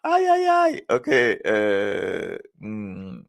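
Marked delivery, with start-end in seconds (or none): 0.0-0.8 mock wail. Real, not acted. Reacting to own joke.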